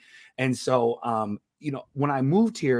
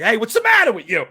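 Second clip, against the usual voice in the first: mock angry Italian, a touch louder; half-hearted Italian outburst; lightly voiced Italian asking why you're mad; 8 semitones higher, 8 dB louder, faster than usual. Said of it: "when Italians get mad".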